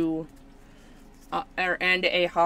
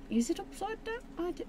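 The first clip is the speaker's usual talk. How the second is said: very high, softer puzzled question; high-pitched questioning; 13 semitones higher, 10 dB quieter than usual. Real, not acted. Card opener checking which way up it is, high-pitched questioning. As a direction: squeak out the doubt softly.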